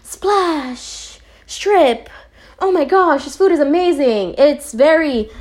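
high-pitched, exaggerated tasting rapture; anime food taster. Reviewer acting out anime tasters' reactions, exaggerated rapture. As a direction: gush like an over-the-top anime food critic. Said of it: "they get a taste of the food and it's very, like".

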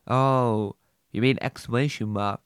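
ordinary talk

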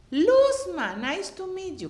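high-pitched friendly hello in English; bright American greeting. Retold or imitated. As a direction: greet her like excited American fans. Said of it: "yo oigo que me dicen las dos".

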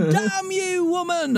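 high mock-Scottish outburst; botched Scottish accent. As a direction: curse her in an accent slipping into Scottish. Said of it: "I'm Scottish now".

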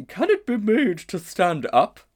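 very high, loud, slow musing elephant; a grand, ponderous lady elephant; she-elephant. A narrator's reading. She-elephant pondering the strange creature. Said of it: "said the elephant thoughtfully".

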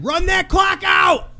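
high, loud yell from the sidelines. Retold or imitated. Parent yelling to the girls from the stands. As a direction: shout it across the court. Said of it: "I was yelling it as loud as I can".

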